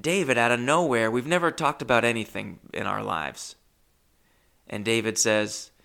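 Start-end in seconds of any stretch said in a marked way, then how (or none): none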